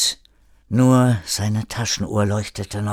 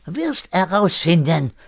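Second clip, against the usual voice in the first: put-on raised, impatient French-accented German; 7 semitones higher than usual. A narrator's reading. René losing patience with Erik, impatient French accent. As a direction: cut off his questions impatiently. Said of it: "René wurde ungeduldig".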